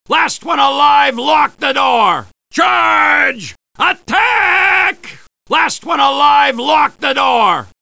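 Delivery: high, shouted military command; battle cry. Acted. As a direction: bellow it to the troops as you charge. Battle orders to charge.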